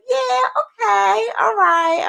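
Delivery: high, drawn-out going along with it; mock agreement. Crocheter going along with others' verdict, mock agreeable. Acted agreement in good-or-bad quiz.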